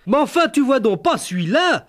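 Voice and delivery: high, pushy rustic woman; insistent country wife. Retold or imitated. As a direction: insist loudly like a peasant wife. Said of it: "insistait-elle".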